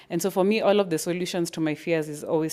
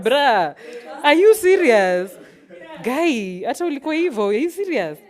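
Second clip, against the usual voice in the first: higher, louder, slowed mock outrage; exasperated disbelief; 8 semitones higher, 9 dB louder than usual. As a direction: play a kid looking back, unimpressed. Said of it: "You're like".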